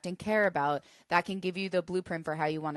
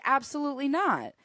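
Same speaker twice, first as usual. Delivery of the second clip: a sharp, raised-pitch "absolutely not"; fierce refusal; 7 semitones higher than usual. Acted. Protecting a best friend from being used, fierce refusal. Defending a loved one's boundaries.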